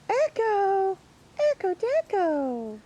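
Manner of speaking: high, loud sing-song call to a dog. Real, not acted. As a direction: sing the dog's name out high. Calling to her dog.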